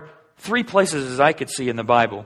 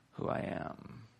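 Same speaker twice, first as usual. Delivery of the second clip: whisper; 17 dB quieter, slower than usual. Acted. Voicing God's name at bush.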